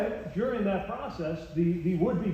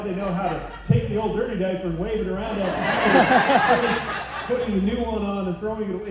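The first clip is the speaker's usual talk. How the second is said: laughing; 6 dB louder than usual. Real, not acted. Funny parenting anecdote, laughing.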